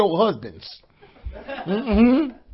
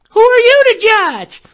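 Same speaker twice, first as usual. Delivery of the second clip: mocking critic; 8 dB louder than usual.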